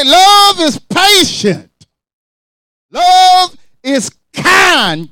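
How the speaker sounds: high, slow, crying shout on "Love is patient. Love is kind."; shouted scripture. Acted. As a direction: wail the verse out loud and slowly. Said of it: "You say".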